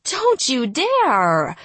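slow, loud horrified cry; shocked plea; gasping panic. Acted. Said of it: "Rihanna gasped".

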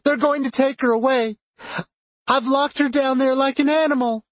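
high, anguished outburst; distraught. A narrator's reading. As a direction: break down, pitched high throughout. Breaking down over locking her up.

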